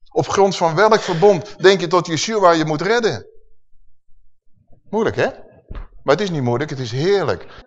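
usual speaking voice

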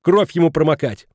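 high, loud scolding; shouted order. A narrator's reading. Mrs Schmidt ordering the wailing woman to fetch a rag, shouting. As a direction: call out sharply over the wailing. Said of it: "Затем крикнула причитающей госпоже Халич".

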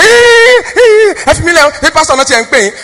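sharp high startled hey, then settling; startled protest. Retold or imitated. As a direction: jump as if touched unexpectedly. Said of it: "touches you in the front like this and say".

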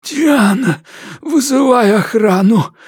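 high, frantic cry for help; terrified boss yelling for security; panicked hiss. A narrator's reading. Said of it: "прошипел Мэтьюс".

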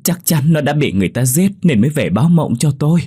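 a desperate insistence that she saw the ghost; insistent protest. Acted. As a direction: refuse to be doubted. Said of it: "cố giải thích cho mọi người hiểu".

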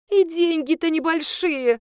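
high, loud blurt, slowed; the accountant blurting her guilt, high and loud. A narrator's reading. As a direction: it slips out before she can stop it. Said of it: "выпалила бухгалтерша".

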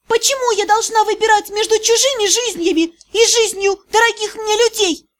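put-on high, shrill-pitched furious girl; furious outburst. A narrator's reading. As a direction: spit it out in rage. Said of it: "Лицо исказилось в ярости".

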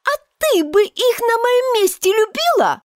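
high, put-upon mouse voice; a small creature, hurt and prickly; high squeaky mouse asking bitterly; offended mouse. Acted. Mouse voice in story reading.

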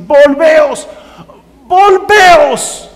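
loud, soaring plea on each "volveos"; emphatic repeated cry. Acted. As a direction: call out VOLVEOS twice, building in pitch. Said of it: "lo dice dos veces por énfasis".

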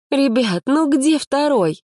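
high, exasperated scolding; indignant. A narrator's reading. Activist chides the boys hiding the lot, indignant. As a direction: scold them like small children, pitched high. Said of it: "возмущалась Марина".

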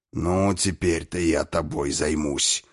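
low, contained growl of a threat; menacing bark. A narrator's reading. Destroyer to the captured snake king, low menacing bark. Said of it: "рявкнул губитель".

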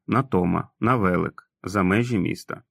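ordinary delivery, nothing marked

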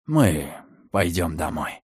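low, slow and soft; tender reassurance. A narrator's reading. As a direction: soothe a lost child home. Soothing a ghost infant. (нежно, успокаивающе)

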